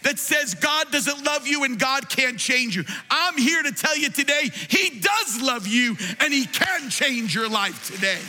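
high, ringing pulpit proclamation; fiery sermon climax declaring God's love; preaching at full force. Acted. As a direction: preach it out to the whole church.

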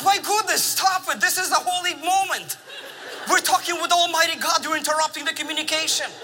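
a father losing patience mid-prayer; frustrated scolding. Retold or imitated. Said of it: "And I'm getting frustrated".

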